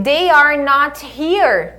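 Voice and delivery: slow, deliberate example; slow model sentence. Shown as a demonstration. Teacher giving a slow example sentence. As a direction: read it out slowly to the class. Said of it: "tanto faz eu dizer".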